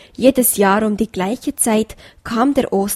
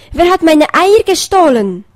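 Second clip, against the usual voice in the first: loud, higher outraged cry; outraged call; 7 semitones higher, 7 dB louder than usual. A narrator's reading. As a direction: shout it into the night, robbed. Hare calls into the night. (laut empört rufen)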